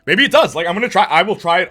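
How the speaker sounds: loud and high, suddenly thrilled; excited burst. Real, not acted. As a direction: blurt it out loud, lit up. Excited repeat of chat line.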